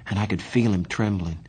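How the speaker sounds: ordinary delivery, nothing marked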